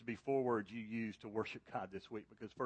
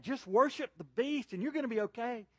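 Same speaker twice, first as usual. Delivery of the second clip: false prophet; 12 semitones higher, 5 dB louder than usual. Acted. False prophet voiced luring people.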